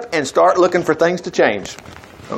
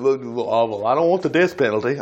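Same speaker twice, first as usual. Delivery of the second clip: mocking timid voice; 5 semitones lower, 5 dB quieter than usual. Mocking polite Americans.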